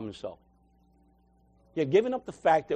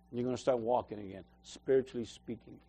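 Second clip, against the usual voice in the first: a soft, tender promise that fades low and quiet; gentle voice of God; 6 semitones lower, 8 dB quieter than usual. Retold or imitated. God telling the crippled man he will walk again, softly and tenderly. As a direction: speak it softly as a loving reassurance. God's words to the preacher.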